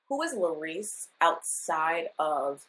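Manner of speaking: ordinary talk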